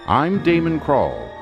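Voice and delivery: usual speaking voice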